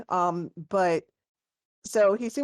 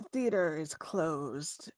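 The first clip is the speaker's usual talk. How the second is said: downcast; 3 semitones lower, 6 dB quieter, much slower than usual. Acted. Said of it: "he kinda hangs his head".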